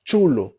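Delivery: Chulo said tchulo, a T before the ch; Spanish chulo. Shown as a demonstration. Teacher repeats for students: Chulo as tchulo. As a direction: start Chulo with a crisp tch. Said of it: "como se tivesse um T antes".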